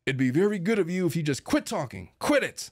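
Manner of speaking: mock-domineering order to be silent; imitated snappish scolding. Wife shutting her husband up, snappish. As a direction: pitch leaps up on the final command. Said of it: "Like that. Like that".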